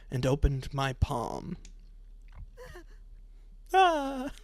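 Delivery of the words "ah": mock scream. Reads the scream at the palm reveal.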